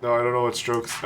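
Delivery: ordinary talk, nothing marked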